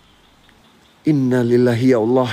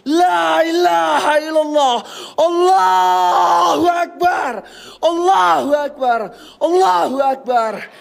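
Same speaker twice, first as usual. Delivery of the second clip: terrified shouted prayer; 7 semitones higher, slower than usual. Real, not acted. Ghost hunter prays against the spirits, crying out in fear. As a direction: cry out Allahu Akbar high and drawn out, near tears.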